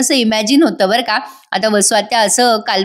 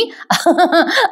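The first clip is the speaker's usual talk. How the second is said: a quick, high burst of laughter; high amused laugh; 8 semitones higher than usual. Real, not acted. Recapping the aunt's cake daydream, a high real laugh. Laughs at her own retelling.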